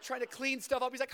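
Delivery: normal talking voice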